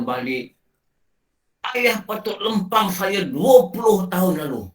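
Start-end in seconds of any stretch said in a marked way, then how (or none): 1.7-4.6 higher, louder pleading son's voice; a son lamenting to his father, higher and louder; regretful son. Acted. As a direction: cry it out as the grown son full of regret. Acting grown son Sazali's regret.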